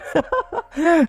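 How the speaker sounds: a sudden high laugh; burst of laughter. Real, not acted. Streamer laughs at the quest.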